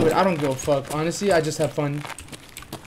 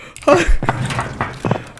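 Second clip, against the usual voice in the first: breathy, high nervous laugh; 10 semitones higher than usual. Real, not acted. Streamer laughing off the jump scare. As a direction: laugh off the fright.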